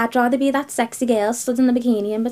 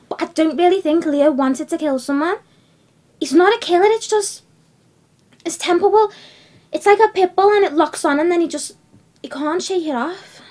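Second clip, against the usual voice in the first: higher, louder, insistent protest; agitated defence; 6 semitones higher, 5 dB louder than usual. Acted. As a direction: leap to his defence, voice pushed up. Heated defence of her boyfriend.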